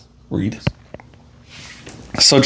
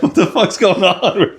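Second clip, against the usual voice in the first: mock-baffled question through laughter; laughing bewilderment; 5 semitones higher than usual. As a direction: act confused while cracking up. Laughing through imagined bewildered reaction.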